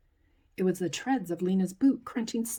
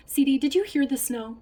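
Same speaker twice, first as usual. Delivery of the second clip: eager; 7 semitones higher, 6 dB louder than usual. Acted. Child eager question.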